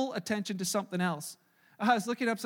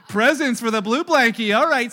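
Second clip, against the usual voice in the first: laughing resignation; 9 dB louder than usual. Retold or imitated. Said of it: "I'm like".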